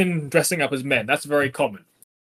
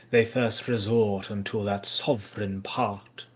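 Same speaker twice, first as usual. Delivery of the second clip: slow, soft, lower dramatic reading; poem recitation; 4 semitones lower, 4 dB quieter than usual. Acted. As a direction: savour each line like a stage recital. Recites the sonnet aloud.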